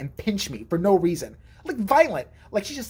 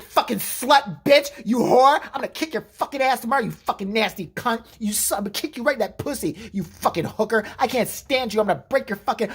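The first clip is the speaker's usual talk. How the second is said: higher-pitched mock abuse, hard on the first words; acted angry tirade; 4 semitones higher than usual. Sister cursing out a girl on three-way call, mock rage. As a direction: tear into them as the furious little sister.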